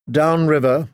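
usual speaking voice

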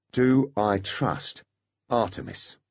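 stretched-out self-question; slow, doubtful. Acted. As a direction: weigh each word slowly. Foley asks himself.

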